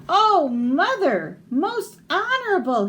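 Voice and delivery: put-on breathless high child voice, slowed; panting. Breathless boy begs his mother after running, panting. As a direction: gasp it out, winded. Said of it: "he panted".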